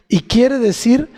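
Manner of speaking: ordinary talk, nothing marked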